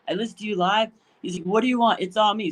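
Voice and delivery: high, eager and generous; the drive-thru worker treating Raj, thrilled; excited fan. Retold or imitated. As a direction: gush and offer the meal. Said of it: "He goes".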